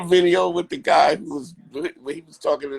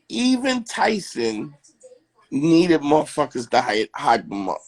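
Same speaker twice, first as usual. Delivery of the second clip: ordinary delivery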